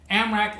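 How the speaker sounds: Amrak said loud and high, stressed; spelling out the name. Shown as a demonstration. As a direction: hit Amrak hard to make it stick. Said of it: "it's just karma spelled backwards".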